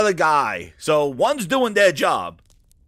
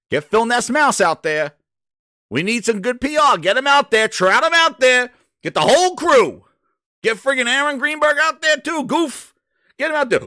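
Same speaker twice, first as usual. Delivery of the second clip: mocking rant; 6 semitones higher than usual. Real, not acted. Ranting, higher and louder.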